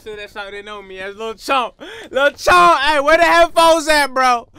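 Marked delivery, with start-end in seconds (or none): 2.1-4.5 loud, high hype shouting. Acted. Rapper hyping himself in the studio, loud excited yelling. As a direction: yell it like you own the booth.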